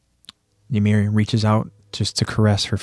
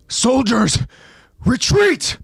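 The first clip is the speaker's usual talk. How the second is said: very high, strained battlefield shout; urgent rallying yell; commander's shouted order; slower than usual. A narrator's reading. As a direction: call the troops back at full force. Commander calls soldiers to retreat.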